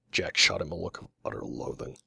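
usual speaking voice

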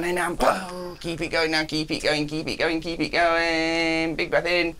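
high, driving encouragement; urgent coaching push. Real, not acted. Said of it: "coach urges patient blowing out".

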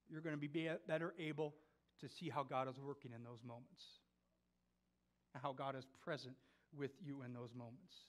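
soft, low, with long pauses; hushed preaching. Real, not acted. Preacher assures church that Jesus is here, hushed and low. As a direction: let each assurance settle quietly.

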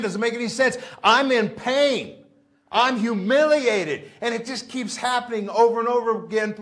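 high, loud, desperate begging to the Lord; preacher voicing Paul's tormented prayer; anguished pleading. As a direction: cry out as if in pain, slowing on the worst words. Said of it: "I can just see it right now".

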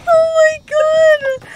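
real scream, high and slow; startled scream. Real, not acted. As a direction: shriek in shock. Sudden fright while playing.

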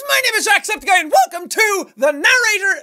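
high, loud YouTube intro yell; intro shout. Acted. Shouting his intro to the viewers, loud and high. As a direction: belt the greeting at the camera.

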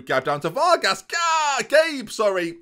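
high, loud excited yell; goal shout. Real, not acted. Streamer commentating a goal, high excited shout. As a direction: erupt as the goal goes in. Goal scored in live match.

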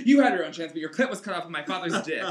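very loud yelling; angry yell. Acted. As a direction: hurl it at mom as you run. Daughter yells at mother.

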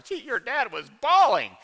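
wife telling their daughter he cried, mock teasing. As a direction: ham up the wife ribbing him to their daughter. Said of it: "She always tells her daughter".

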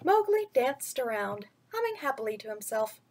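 put-on gentle storyteller voice reading to children; bedtime story reading. A narrator's reading. Vlogger reading a picture book to kids, storytelling voice.

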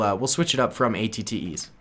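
ordinary talk, nothing marked

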